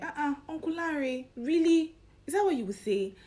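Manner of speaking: hammed-up shocked niece telling off her uncle; scolding niece. Niece to Uncle Larry, loud mock-scandalized scolding.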